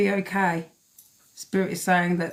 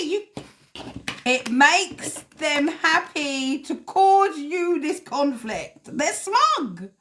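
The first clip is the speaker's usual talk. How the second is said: mocking sneer; 8 semitones higher, much slower than usual. Mocking the smug wrongdoers.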